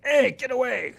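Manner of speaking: sudden high-pitched yelp; startled cat. A narrator's reading. Cat startled by a bird mid-mutter, high cross yelp. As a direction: burst out in a squeaky shout.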